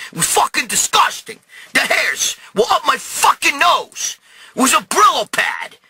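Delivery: high, worked-up rant of revulsion; agitated disgust. Real, not acted. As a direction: pitch it up, appalled and frantic. Disgusted account of being smothered.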